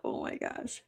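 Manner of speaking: ordinary delivery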